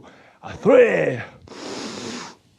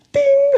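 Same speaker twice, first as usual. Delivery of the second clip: idea ding. Acted. Puppet gets an idea.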